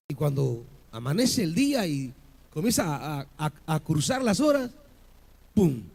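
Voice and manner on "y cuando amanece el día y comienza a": ordinary talk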